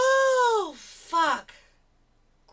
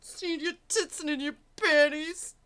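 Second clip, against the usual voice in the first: mock whiny girlfriend; 8 semitones higher, 6 dB louder, slower than usual. Mocking girlfriend's clip line.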